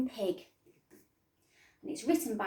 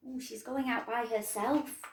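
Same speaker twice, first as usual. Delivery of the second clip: ordinary delivery, nothing marked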